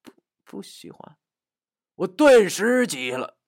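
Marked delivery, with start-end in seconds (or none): 0.0-1.2 very soft, hesitant stammer; barely voiced, faltering mumble; murmuring. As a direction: murmur a shy denial. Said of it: "喃喃地说".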